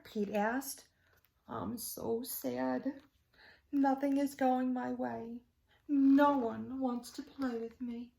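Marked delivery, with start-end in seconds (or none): 1.5-8.1 slow, mopey alligator voice; sad alligator. Acted. Alligator telling Pete no one plays with him, sad. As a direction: sigh it out, downcast. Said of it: "Alligator did not look happy".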